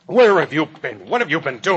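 loud, high interrogation; jealous husband's outburst; angry confrontation. Acted. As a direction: demand that she account for herself. Husband confronts wife coming home late.

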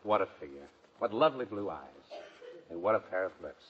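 low, soft, swooning murmur; dreamy admiration. Acted. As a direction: savor each thought dreamily, voice sinking. Swooning over Betty Grable.

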